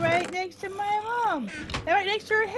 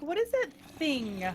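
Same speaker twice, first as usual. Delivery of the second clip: lower, slow mock-puzzled mom voice; a lightly voiced confused mom; imitating mom; 11 semitones lower, 6 dB quieter, much slower than usual. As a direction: drop low and drag it out like a bewildered mother. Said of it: "She's like".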